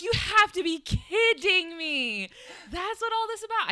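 loud, high-pitched disbelieving outburst; exasperated shout, words stretched out; reliving the shock at mic-blowing volume; losing it. Retold or imitated. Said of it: "I just blew out my levels so bad".

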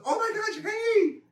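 very high mock squeal; squeaky, put-on delighted hello; gushing greeting. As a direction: imitate an over-excited girl saying hi. Imitating girl's gushing greeting.

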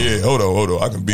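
a mock-serious deep voice stalling the producer; put-on deep voice. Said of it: "I had to put on the deep voice".

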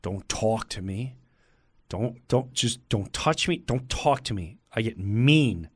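low, soft, tense warning; hushed don't-bother-me voice; nervous warning. Retold or imitated. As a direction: keep it down and menacing. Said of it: "when I'm nervous".

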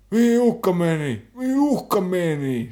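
high, slow, loud shout, still half mock; retold shouting. Junkie yelling in the shop about the threat, loud slow shout. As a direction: bellow it like a furious junkie in a shop. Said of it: "Huutaa ihan täysiä".

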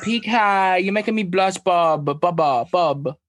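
high, bashful lovey-dovey voice; coy flirty husband. Retold or imitated. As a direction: gush like a blushing husband. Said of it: "He was like".

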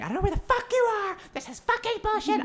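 high-pitched mock scream; imitating mom screaming. Retelling mom screaming on the phone, high mock shriek. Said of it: "my mom starts just screaming at me".

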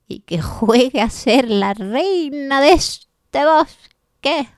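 put-on creaky old lady, slow; slowly, like a very old woman. Laughing old woman recites childhood wishes.

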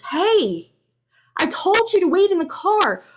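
high, loud scolding; officer yelling. A narrator's reading. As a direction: yell at someone who disobeyed. Officer grabs him, yelling.

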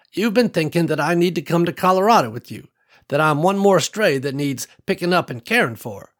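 put-on old farm woman Ruby. Ruby telling Nicole about her land, lightly voiced old woman. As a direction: let a hint of an old rancher widow in.

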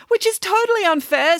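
high-pitched, whining protest; indignant schoolgirl. Acted. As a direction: complain to teacher in a high, aggrieved voice. Mirabella protests to the teacher.

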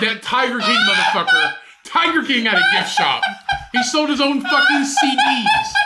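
high, loud, worked-up amused argument; loud comic rant. Real, not acted. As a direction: rant loudly to be heard over laughter. Heated comic argument, backing claim.

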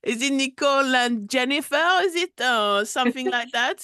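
loud, high put-on caller voice; overdone French caller; prank caller voice. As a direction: boom down the phone as a fake fan. Fake caller voice prank call.

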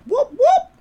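very high, loud whoop whoop; high cartoon gulping-air whoop; squeaky game noise. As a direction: chirp it like a video game bubble sound. Imitating Sonic air bubble.

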